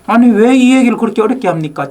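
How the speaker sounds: 높은 음으로 따지듯 항의; 답답해서 목소리 높여 따지기; 따지는 말투. Retold or imitated. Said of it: "좀 따지고 싶어 마음이 있었던 거죠". (발표자에게 따지는 청중, 높은 음으로 항의)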